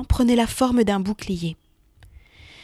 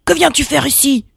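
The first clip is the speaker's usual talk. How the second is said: loud, higher, harsh giant roar; monster's shout; 5 semitones higher, 6 dB louder than usual. Acted. The Cyclops shouting at the caught Hermès. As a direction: roar at the intruder you just caught. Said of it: "cria-t-il".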